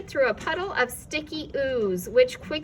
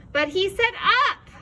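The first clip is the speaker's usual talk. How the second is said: higher, louder complaint; bitter complaint; 9 semitones higher, 7 dB louder than usual. A narrator's reading. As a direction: protest the trick. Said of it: "Milo complained bitterly".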